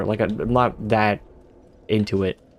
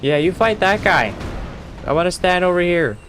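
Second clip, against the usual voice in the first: nervous alarm; 8 semitones higher than usual. Real, not acted. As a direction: yelp and back away. Fleeing a big enemy.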